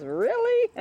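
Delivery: high, loud mock sass; voicing the dog. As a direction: play the bossy dog snapping back. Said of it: "she snaps him back into place and says".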